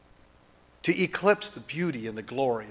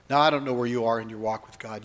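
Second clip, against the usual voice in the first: ordinary talk, nothing marked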